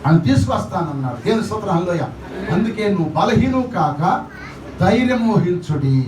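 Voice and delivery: usual speaking voice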